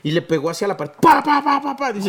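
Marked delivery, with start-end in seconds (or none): 1.0-1.9 golpes imitados más agudos; pa, pa como eco de botella; imitando golpes. Retold or imitated. (golpes de botella imitados)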